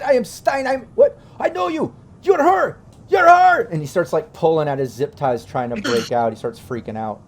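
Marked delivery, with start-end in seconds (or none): 0.0-3.6 high-pitched, rising to a loud shriek; frantic recognition. Acted. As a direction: panic climbing into a scream. Stein recognizes her, freaking out.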